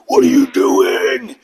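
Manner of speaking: high, slow, mocking yell; mock drill instructor. Drill instructor scolding a lost recruit, high mocking yell. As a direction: ham up a drill instructor's sneer. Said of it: "he's like".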